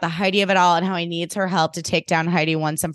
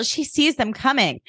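usual speaking voice

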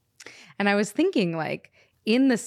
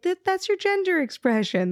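teasing friend; 10 semitones higher than usual. Retold or imitated. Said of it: "He's like".

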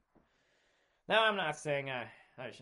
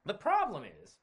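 ordinary talk

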